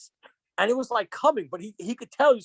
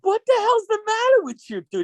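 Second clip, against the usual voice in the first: mock shocked; 12 semitones higher, 4 dB louder than usual. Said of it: "everybody else in the car was like".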